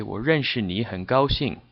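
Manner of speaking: 我认识你 很高兴 said smoothly in one flow; natural flowing take of 我认识你 很高兴; fluid. Shown as a demonstration. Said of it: "to say it more fluidly".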